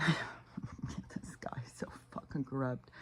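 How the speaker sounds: slow, breathy disgusted whisper after a laugh. Real, not acted. Vlogger whispers in disgust about the corrupt politician. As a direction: hiss it quietly in disgust.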